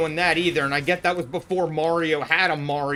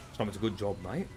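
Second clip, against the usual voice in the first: low, soft put-on voice; bad mercenary impression; 8 semitones lower, 9 dB quieter than usual. Streamer doing rapid-fire impressions, low soft character voice. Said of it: "Here are my shitty impressions".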